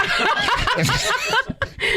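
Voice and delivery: high breathy burst of laughter; big laugh. Real, not acted. Husband laughing at his wife's soup habit. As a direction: laugh hard at the story.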